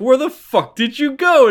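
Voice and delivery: high, loud demanding shout; mock angry yell. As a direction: yell at the friend who vanished. Characters demand where traveler went.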